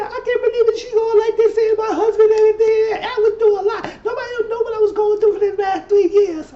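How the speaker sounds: very high-pitched, wailing imitation; mock crying. Woman crying in the bathroom about her husband, mock tearful wail. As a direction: exaggerate a tearful complaint in a high voice. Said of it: "She was crying".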